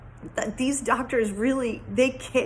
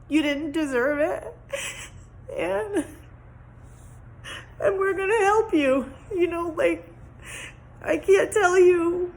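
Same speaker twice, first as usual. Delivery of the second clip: choked up, fighting tears; crying; 6 semitones higher than usual. Real, not acted. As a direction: voice breaking with tears. Tearful gratitude to doctors.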